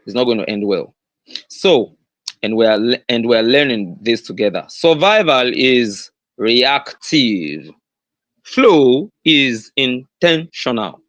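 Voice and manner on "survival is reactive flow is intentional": very slow, spaced-out key statement; measured teaching emphasis, drawn out; slow, deliberate. Real, not acted. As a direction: spell out the key point word by word. Key statement delivered, then repeated.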